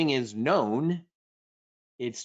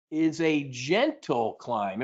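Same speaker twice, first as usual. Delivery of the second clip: ordinary talk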